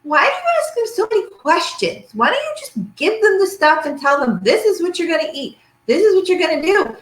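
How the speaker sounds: the skeptical mom lecturing her daughter; lightly hammed-up doubting mother; imitating mom. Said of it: "She says".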